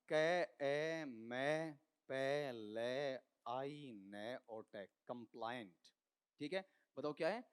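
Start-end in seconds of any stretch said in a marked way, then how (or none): 0.1-4.9 "कै, ए, मै, पे, ले" slowly, one sound at a time; sound-by-sound pronunciation. Shown as a demonstration. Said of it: "इसका उच्चारण क्या आता है?". 5.1-5.8 "कंप्लायंट" said as kum-PLAA-yunt, low; demonstrated pronunciation. Shown as a demonstration. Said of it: "इसका उच्चारण भी सुन लो".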